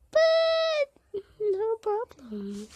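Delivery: high, loud, drawn-out cry; the words crack into a real wail; real tearful outburst, very slow; breaking into a wail. Real, not acted. Upset about the puppy's death.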